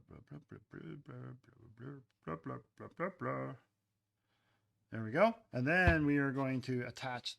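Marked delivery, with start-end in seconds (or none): 0.1-3.5 quiet run of babbled blahs; playful slathering noises. Pretend. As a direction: babble softly along with the work. Noises while spreading slip.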